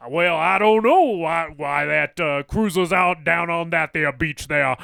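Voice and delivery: mock Southern security chief complaining loudly; Southern accent. Podcaster imitating an alien security guy with a Southern accent. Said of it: "he has, like, a southern accent".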